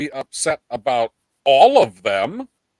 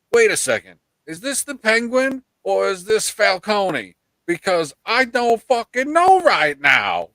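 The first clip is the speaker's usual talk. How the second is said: confused outburst; 8 semitones higher than usual. Retold or imitated. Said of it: "and I was like".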